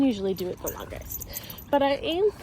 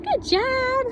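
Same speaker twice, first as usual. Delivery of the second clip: happy praise; 4 dB louder than usual. Real, not acted. Trainer praising her Frenchie, high and excited. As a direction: gush praise up high at the dog.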